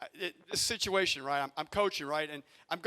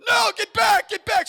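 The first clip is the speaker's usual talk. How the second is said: retold frantic shout, much higher and louder; panicked yell; 13 semitones higher, 13 dB louder than usual. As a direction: scream the runner back to base. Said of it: "I'm like".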